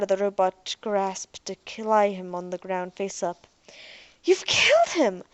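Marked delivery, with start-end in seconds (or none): 4.2-5.2 a high, loud accusing cry; acted scream. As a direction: scream it at the robot in shock. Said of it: "Ariel screamed".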